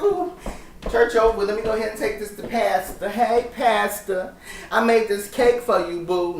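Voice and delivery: hammed-up coy greeting; flirty churchgoer act. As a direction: sweet-talk the pastor in a put-on high voice. Playing flirty churchgoer approaching pastor.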